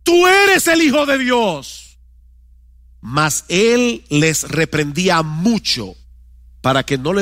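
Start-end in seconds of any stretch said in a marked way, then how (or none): 0.0-2.0 crying out. Acted. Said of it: "daban voces diciendo".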